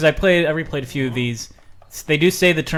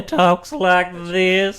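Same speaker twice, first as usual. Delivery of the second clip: Slim Pickens; 4 semitones higher, 3 dB louder, much slower than usual. Pretend. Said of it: "did the Slim Pickens".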